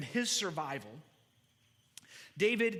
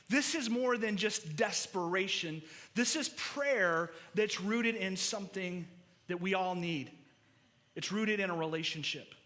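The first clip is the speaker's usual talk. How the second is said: ordinary talk, nothing marked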